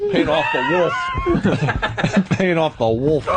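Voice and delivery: words broken up by laughter; can barely get it out for laughing; giggling while repeating the punchline; laughing through it. Real, not acted. Cracking up at his own joke.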